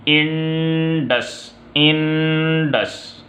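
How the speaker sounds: Indus stretched out very slowly, opening vowel clear; teacher modelling Indus slowly; very slow model word. Shown as a demonstration. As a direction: drag out Indus so the vowel start is heard. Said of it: "अलिफ की आवाज़ दे रहा है".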